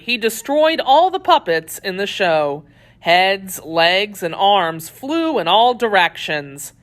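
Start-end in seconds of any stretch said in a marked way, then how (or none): none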